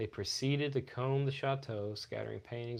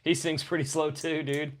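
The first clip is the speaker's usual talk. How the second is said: laughing; 5 semitones higher, 6 dB louder than usual. Real, not acted. Laughing joke.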